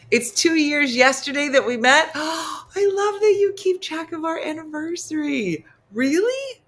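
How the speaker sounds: high-pitched gushing, rising to a squeal; gushing delight. Pretend. Friends marking two years since meeting, gushing delight.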